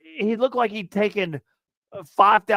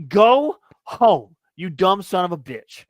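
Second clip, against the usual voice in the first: loud, raised shout of go home; angry yell; 7 dB louder, slower than usual. Retold or imitated. Podcaster ranting at a rude fan, furious shout. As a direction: blast it at the fan. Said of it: "let me go on a little rant here".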